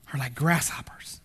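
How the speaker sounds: hushed, awed whisper; reading scripture in an awed whisper. Real, not acted. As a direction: let it fall to a whisper of wonder. Awed reading of God's greatness.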